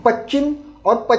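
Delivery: "Pachim" with the sh dropped; unclear variant. Retold or imitated. Teacher showing how some Indians pronounce west, unclear variant. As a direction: say "Pachim" as pach-chim, no sh. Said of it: "they may pronounce it as a Pachim".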